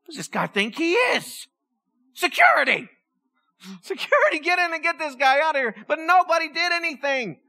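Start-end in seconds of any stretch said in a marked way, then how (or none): 2.1-5.7 shouting for security, pitch jumping high; mock call for security. Pastor playing a church boss shouting for security. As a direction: bellow for the guards to come.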